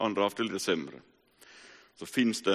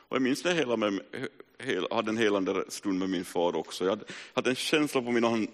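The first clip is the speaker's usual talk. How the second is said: usual speaking voice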